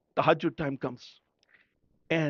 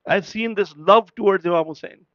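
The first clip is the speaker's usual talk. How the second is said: ordinary talk, nothing marked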